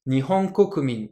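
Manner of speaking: Nihon Kokumin with pitch rising then falling, read clearly; Japanese pitch demo. Shown as a demonstration. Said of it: "On monte ici, on descend ici".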